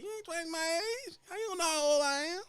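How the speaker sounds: high, mock-shocked squeal; flattered older woman. Older lady mock-shocked he guessed her age. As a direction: shriek it high, flattered to bits. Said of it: "Well, I'm about twice as your age".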